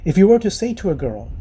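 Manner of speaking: ordinary talk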